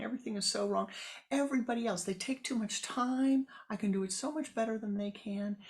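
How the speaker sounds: complaining woman. Acted. The seized woman complains loudly to her neighbor. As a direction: pour out grievances loudly.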